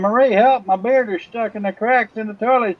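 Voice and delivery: high panicked call for help; yelling for help. Acted. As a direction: cry out, stuck and desperate. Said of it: "starts yelling".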